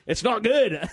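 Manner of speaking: loud, high mock Scottish; playful stage-Scottish accent. Shown as a demonstration. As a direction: finish the hammed-up Scottish catchphrase. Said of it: "I can do a Scottish accent".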